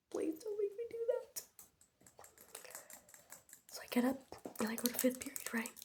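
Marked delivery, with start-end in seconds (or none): 0.1-1.4 pleading. Retold or imitated. Begging teacher not to go to the board.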